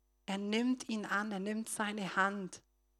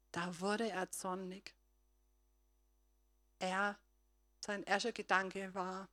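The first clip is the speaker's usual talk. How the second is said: usual speaking voice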